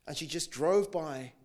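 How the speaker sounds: usual speaking voice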